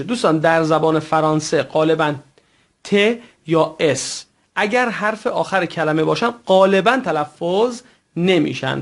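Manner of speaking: usual speaking voice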